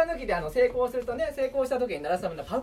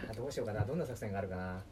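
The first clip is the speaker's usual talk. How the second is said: scheming mutter; 11 semitones lower, 9 dB quieter than usual. Pretend. Scheming aloud.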